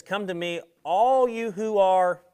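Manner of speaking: reading scripture with swelling emotion. Real, not acted. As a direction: lift WEARY with rising passion. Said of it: "the N I V says it like this".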